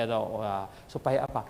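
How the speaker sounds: usual speaking voice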